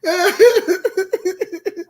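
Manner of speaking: high-pitched, helpless laughter between phrases; words squeezed out through giggles; laughing. Real, not acted. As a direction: talk while cracking up for real. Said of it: "I hope that I laughed too much".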